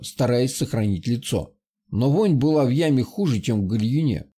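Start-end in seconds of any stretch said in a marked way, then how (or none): none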